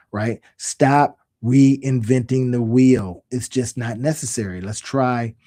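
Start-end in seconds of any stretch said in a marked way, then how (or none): 0.6-3.3 slow, deliberate, louder "Stop reinventing the wheel"; slow emphatic. Shown as a demonstration. Coach drilling his main rule to students, slow and firm. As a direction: spell the rule out word by word. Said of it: "we gotta stop that".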